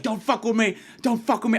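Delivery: a light imitation of a tough threat; aggro stranger. Stranger turning aggro at the arguing comedian, mock threat. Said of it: "he gets a little aggro".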